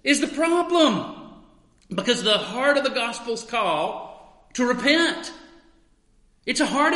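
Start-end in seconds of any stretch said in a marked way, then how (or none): 0.0-1.1 Paul defending himself, mild earnest plea; Paul insisting. Retold or imitated. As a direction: press the point gently, as Paul. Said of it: "Paul said".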